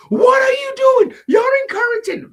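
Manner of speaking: very high, loud outraged woman; hammed-up shriek of protest; shrill mock Karen yelling.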